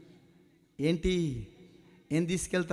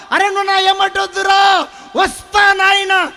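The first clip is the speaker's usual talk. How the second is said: very high shouted back and forth, slowing at the end; father and sin arguing; 11 semitones higher, 14 dB louder than usual. Acted. Sin insists on following the father: loud, high and slow. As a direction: shout the refusal, then drag out the promise.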